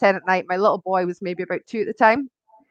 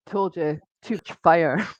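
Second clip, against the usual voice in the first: low, quiet dragon's told-you-so; understated character voice, dropped low and soft; Frank; 6 semitones lower, 5 dB quieter, slower than usual. Retold or imitated. Fire dragon to the owner after the chaos, low smug aside. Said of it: "all I heard Frank going was".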